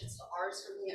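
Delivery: ordinary talk, nothing marked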